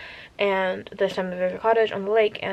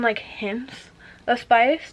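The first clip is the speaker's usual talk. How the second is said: usual speaking voice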